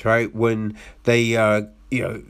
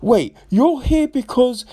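incredulous; 11 semitones higher than usual. Retold or imitated. Incredulous at film characters.

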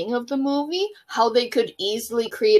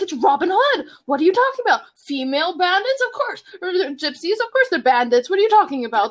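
smug Prince John; 6 semitones higher than usual. Retold or imitated. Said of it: "he's like".

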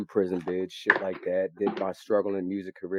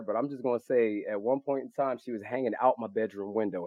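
ordinary talk, nothing marked

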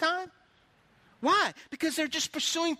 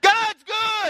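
much higher, full-volume jubilant holler; imitated shouting; 10 semitones higher, 10 dB louder than usual. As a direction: shout it as the excited pastor would. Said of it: "he's shouting".

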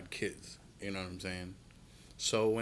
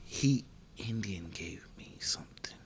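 slow, voiceless whisper; hushed whisper; 3 semitones lower, 7 dB quieter, slower than usual. Real, not acted. Co-host sharing a touchy remark with the host, whispered. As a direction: whisper it slowly, like something not to be said aloud.

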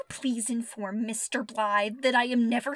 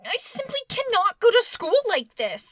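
distressed; 8 semitones higher, 6 dB louder than usual. Acted. Anne upset.

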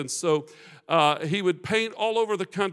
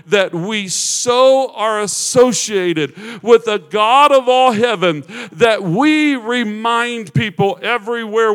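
loud, raised-pitch preaching at full force; impassioned preaching; 5 semitones higher, 10 dB louder, slower than usual. Real, not acted. Preacher to congregation about resembling the Father, impassioned preaching. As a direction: build into a fervent, shouted prayer.

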